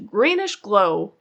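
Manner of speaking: plain reading voice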